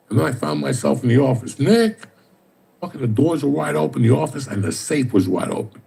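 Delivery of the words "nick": calling out. Retold or imitated. Calling for Nick in office.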